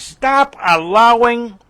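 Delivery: "stop allowing" slow, high and stretched; drawn-out emphatic command. Acted.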